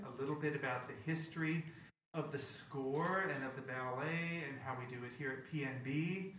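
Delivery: usual speaking voice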